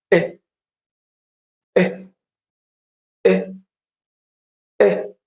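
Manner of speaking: brief "إيه", vowel not held at all; a quick "eh" for "إيه", stopped at once; short E sound. Shown as a demonstration. Teacher drills learners on the first sound, short "إيه". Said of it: "لا مد طويل ولا مد قصير".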